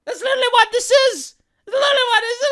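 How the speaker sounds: high, loud insistence; exasperated shout. Real, not acted. YouTuber shouting his toilet joke punchline. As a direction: yell it as the punchline.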